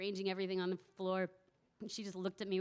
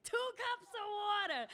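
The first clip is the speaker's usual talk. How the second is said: much higher, excited proclaiming; old woman gleefully proclaiming the recipe, much higher; animated reading; 12 semitones higher than usual. Retold or imitated. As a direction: announce it like a triumph. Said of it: "more and more excited about it and more animated".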